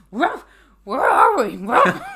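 loud, slow, confused dog barking out words. Pretend. Podcaster voicing the lost dog, loud and slow. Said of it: "even the dog was like".

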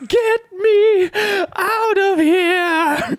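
put-on high, shrill old lady scream; screaming grandma. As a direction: shriek it like a panicked grandma. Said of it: "the grandma, like, jumps on the car".

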